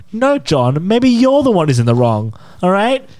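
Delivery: high, rising mock-angry comeback; imagine finally losing patience on the phone; imagined snap at caller. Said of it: "he almost made me flip my crap".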